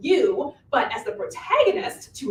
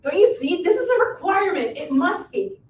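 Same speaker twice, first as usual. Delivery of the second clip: higher, louder, eager; excited insistence; 6 semitones higher, 4 dB louder than usual. Acted. Witch convinces the hero it fits, excited. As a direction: push the idea with growing excitement.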